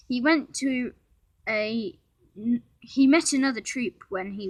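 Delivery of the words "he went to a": ordinary delivery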